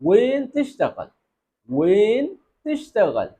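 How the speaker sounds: وین تشتغل said slowly, every sound spelled out; slow clear demo. Shown as a demonstration. Teacher models the correct وین تشتغل slowly for students. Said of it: "ایسا نہیں بولنا".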